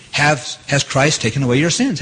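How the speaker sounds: ordinary delivery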